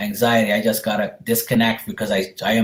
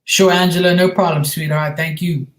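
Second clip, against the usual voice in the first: higher and louder, cheerful; greeting a thankful viewer, higher and louder, delighted; bright reply; 6 semitones higher, 4 dB louder than usual. Real, not acted. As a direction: lift the voice for a happy sign-off.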